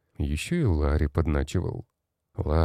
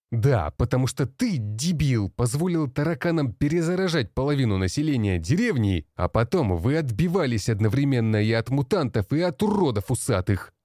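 angry shouting; 8 semitones higher than usual. A narrator's reading. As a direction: tear into him in rage. Boss berating a soldier. (гневная тирада)

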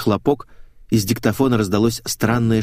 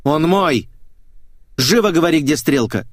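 higher, forceful angry demand; злое требование; 8 semitones higher, 4 dB louder than usual. A narrator's reading. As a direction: demand an answer right now. Ревнивая ссора, требует ответа. (зло, напористо, повышенным голосом)

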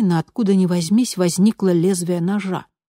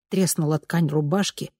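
usual speaking voice

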